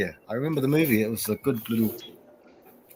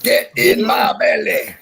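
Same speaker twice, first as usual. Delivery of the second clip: Fat Bastard shout; 3 semitones higher than usual. Retold or imitated. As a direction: bark it like the greedy movie brute. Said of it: "Fat Bastard".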